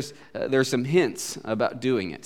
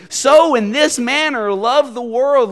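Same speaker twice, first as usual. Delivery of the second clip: SO hit loud and high, then slow; stress on SO; 10 semitones higher, 8 dB louder, slower than usual. Shown as a demonstration. Preacher expounding the verse word by word, stress on So. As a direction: punch the word So and stretch the line. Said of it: "each time emphasizing a different word".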